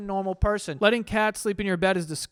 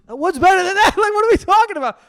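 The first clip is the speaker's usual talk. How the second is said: much higher and louder, laughing in disbelief; excited disbelief; 12 semitones higher, 11 dB louder, faster than usual. Real, not acted. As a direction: shout it like it's obvious. Incredulous reaction, laughing.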